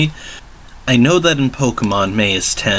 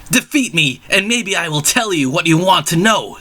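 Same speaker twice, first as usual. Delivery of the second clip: high villain taunt; 7 semitones higher than usual. Acted. Villain Titus challenges.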